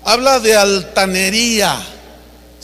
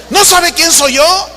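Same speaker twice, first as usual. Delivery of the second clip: higher, louder arrogant demand; arrogant outburst; 7 semitones higher, 4 dB louder than usual. Retold or imitated. Famous preacher shouting his fame at the agent, louder. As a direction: throw your fame at her. Celebrity pulls rank.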